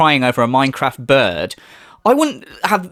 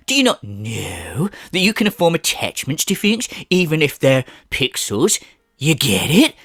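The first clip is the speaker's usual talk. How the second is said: whispered mocking imitation; a hushed, sarcastic parody whisper. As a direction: whisper it like a wounded defender. Mocking Phil defensively.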